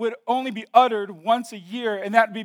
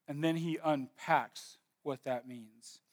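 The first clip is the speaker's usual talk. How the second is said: much softer, slow; hushed aside; 6 semitones lower, 14 dB quieter, slower than usual. Real, not acted. Preacher dropping to a near-hush for the congregation. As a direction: drop it right down and slow. Drop after quote.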